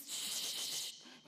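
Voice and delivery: sharp hissed "shh"; acted shushing sound; silencing shush. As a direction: hush the negative voices. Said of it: "calla esas voces".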